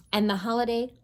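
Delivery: plain reading voice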